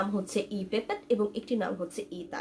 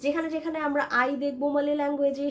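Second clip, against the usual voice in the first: usual speaking voice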